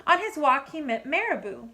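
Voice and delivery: ordinary delivery, nothing marked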